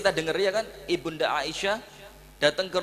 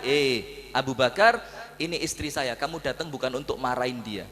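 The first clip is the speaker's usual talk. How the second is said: calm defence; 6 semitones lower than usual. Acted. The Prophet defends his wife. (membela istri dengan tenang)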